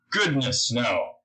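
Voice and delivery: usual speaking voice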